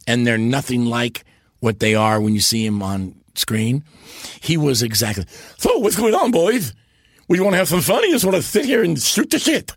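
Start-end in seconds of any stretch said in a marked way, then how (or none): 5.6-9.7 put-on high, lively old vaudeville comic voice; a jolly showman ribbing the boys; Ed Wynn. Great-nephew imitating the uncle greeting the boys, high and jolly. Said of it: "first do an imitation of him".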